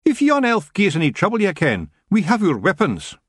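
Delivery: high, feisty Scottish threat; small fighter itching for a scrap; threatening Scots clansman. Acted. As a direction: warn her off in broad Scots. Feegle threatens the elf.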